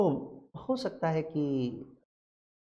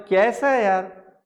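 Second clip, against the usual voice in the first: mocking friend; 5 semitones higher, 9 dB louder, slower than usual.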